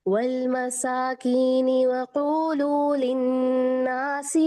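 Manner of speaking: slow, melodic tajweed recitation; measured Quranic chant. Acted. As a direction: chant each verse slowly and evenly. Quran recitation before lesson.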